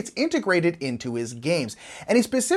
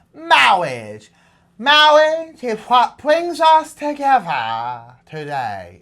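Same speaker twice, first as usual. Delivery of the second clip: slow, loud, lisping wedding officiant; Princess Bride clergyman; 4 semitones higher, 5 dB louder, much slower than usual. Retold or imitated. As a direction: drag out "Mawwiage" like the impressive clergyman.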